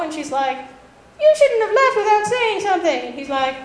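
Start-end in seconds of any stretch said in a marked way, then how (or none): 1.2-3.0 higher put-on scolding; mock scolding girl. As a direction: chide him like a miffed manga girl. Annoyed girl scolds returning boy.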